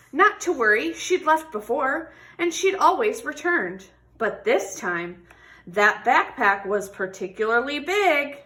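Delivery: expressive picture-book read-aloud; reading to children. Acted. Teacher reading a tortoise story to children, expressive. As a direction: tell the story warmly to a class.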